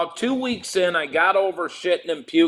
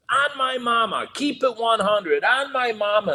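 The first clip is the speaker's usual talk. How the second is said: higher, mocking chant of a loudmouth inmate; inmate's catchphrase; 8 semitones higher than usual. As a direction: parrot the bragging guy in the bullpen, pitched up. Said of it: "And all I hear is".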